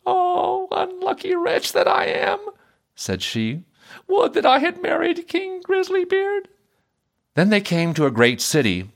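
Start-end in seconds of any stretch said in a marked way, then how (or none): 0.0-2.4 high-pitched, woeful wail in character; lamenting princess. A narrator's reading. Princess learning what she could have had, lamenting. 4.1-6.5 very high, regretful princess voice; lamenting princess. A narrator's reading. Princess married to a fiddler, lamenting.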